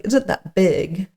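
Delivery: ordinary talk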